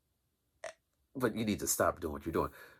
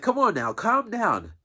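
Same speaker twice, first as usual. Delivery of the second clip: higher, louder mock teacher pleading; a much higher imitation of a flustered teacher; imitating the teacher; 13 semitones higher, 5 dB louder than usual. Teacher calming the class.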